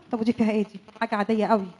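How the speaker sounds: soft, low muttering while reading; muttering to herself. Acted. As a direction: read his phone under your breath. Wife snooping phone, muttering.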